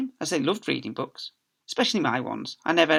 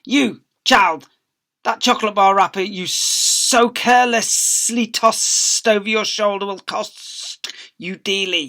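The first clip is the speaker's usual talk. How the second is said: higher, louder, slow menacing voice; hissing librarian; 7 semitones higher, 9 dB louder, slower than usual. Acted. Miss Spick threatening a girl over a wrapper, menacing. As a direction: threaten the child slowly. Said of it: "she hissed".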